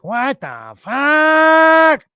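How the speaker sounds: slow, high, loud exasperated drawl; grumpy outburst. Retold or imitated. Grumpy girlfriend woken early, loud exasperated groan. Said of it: "she's like".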